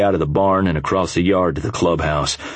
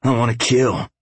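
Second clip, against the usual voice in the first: growl; 6 semitones higher than usual. Acted. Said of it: "Flame growled".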